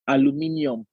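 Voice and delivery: "Aluminium" said a-lu-MI-ni-um, high; Nigerian pronunciation. Retold or imitated. Student presenting in chemistry class, "Aluminium" with a sounded I. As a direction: say "Aluminium" in full, with the "ni-um" ending. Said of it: "And I said".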